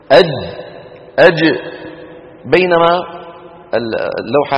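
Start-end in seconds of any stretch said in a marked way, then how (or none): 0.1-1.6 أج closed hard, then voiced release; voiced jim release. Shown as a demonstration. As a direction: let the jim of أج burst out voiced. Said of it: "انتبهنا كيف". (أج بجيم شديدة مجهورة)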